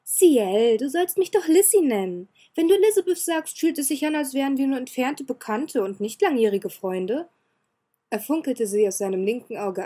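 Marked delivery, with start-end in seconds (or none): 0.0-0.8 put-on cheerful girl's call. Elizabeth coaxing her grumpy friend, cheerful. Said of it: "Wieder versuchte sie es mit einem heiteren Tonfall". 0.8-7.3 put-on higher, louder girlish voice, playful and coaxing; cheerful young girl. Elizabeth teasing Ciel about her nickname, bright and girlish. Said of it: "Wieder versuchte sie es mit einem heiteren Tonfall".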